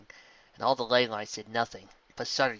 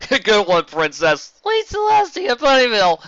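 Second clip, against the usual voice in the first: loud, high, joking; jovial shout; 11 semitones higher, 13 dB louder, slower than usual. Acted. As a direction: rib her cheerfully. Stallion joking loudly with Luna.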